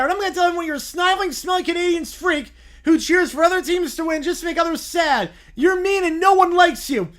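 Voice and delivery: put-on a sulking kid about to lose it; upset whiny bear. Bear yells back.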